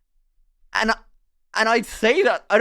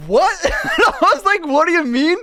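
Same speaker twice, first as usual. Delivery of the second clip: laughing disbelief; 9 semitones higher, 5 dB louder than usual. Retold or imitated. Insulted out of nowhere, laughing.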